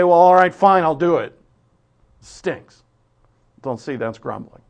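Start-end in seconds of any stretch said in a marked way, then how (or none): 0.0-1.4 loud, grudging sigh of a complainer; grudging complaint. Retold or imitated. Preacher voices a grumbling believer, grudging and loud. 2.2-2.8 muttered complaint. Retold or imitated. Grumbled aside.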